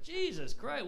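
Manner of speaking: a high, startled exclamation; jolted by the answer; shocked. Retold or imitated. Reacts to goat guy's list.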